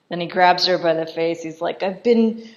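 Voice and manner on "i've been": loud, hammed-up grievance, pitch leaping early; mock outraged declamation. Marquis complaining into his wife's face, loud mock outrage.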